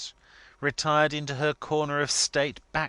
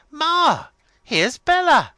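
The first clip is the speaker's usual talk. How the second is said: high, loud bawl to Ma; 13 dB louder, slower than usual. A narrator's reading. Miss Lavvy calls her mother, loud excited bawl. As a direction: bawl it through the house. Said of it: "and then bawled".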